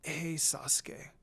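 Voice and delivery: slow, soft and raspy, just waking. A narrator's reading. Lucy waking after days, greeting Sasuke, slow and raspy. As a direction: croak it out like after days of silence. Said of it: "I spoke with a raspy voice".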